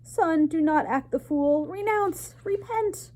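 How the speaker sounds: very high, loud desperate plea; mother begging her son, high and urgent; French mother pleading. A narrator's reading. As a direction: beg your son to repent.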